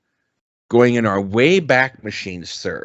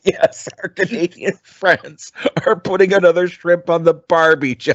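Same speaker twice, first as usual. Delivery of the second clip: laughing; 8 semitones higher than usual. Real, not acted. Joking about Australian cliché.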